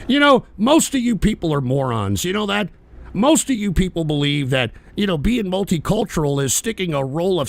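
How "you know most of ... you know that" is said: ordinary talk, nothing marked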